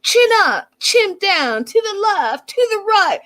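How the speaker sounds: stage mom. Retold or imitated. Pushy stage mom coaching him through a photo shoot, high-pitched mock commands. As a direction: fire off posing orders like a pushy stage mom. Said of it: "like, a stage mom".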